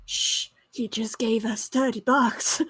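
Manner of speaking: normal talking voice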